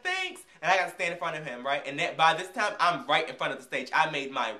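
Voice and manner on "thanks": sweet thanks. Retold or imitated. Thanking tall man.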